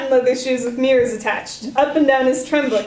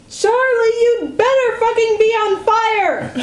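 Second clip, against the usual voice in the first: angry yell; 11 semitones higher, 3 dB louder, slower than usual. Acted. Sister yells at Charlie.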